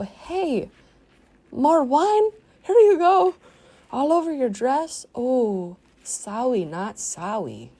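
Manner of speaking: high, loud mock-sweet hostess; fake sweet. Pretending to spill wine on B-Face, high mock sweetness. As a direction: pour sarcasm like wine. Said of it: "I'd just go".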